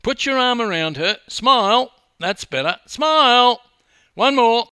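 loud, high-pitched calls; photographer shouting directions. A narrator's reading. Photographer posing two swimmers, shouting directions. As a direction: fire off orders at full volume.

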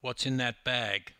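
plain reading voice

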